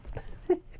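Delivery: short breathy disbelieving laugh; wry laugh. Real, not acted. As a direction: laugh in dark amusement. Laughs at bad news.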